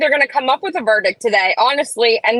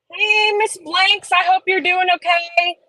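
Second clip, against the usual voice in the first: sweet greeting; 7 semitones higher than usual. Real, not acted.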